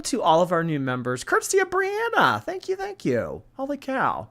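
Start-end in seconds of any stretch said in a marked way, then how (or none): 1.2-4.3 high, delighted shout-out; gushing thanks. Real, not acted. Streamer credits a viewer for new memberships, gushing.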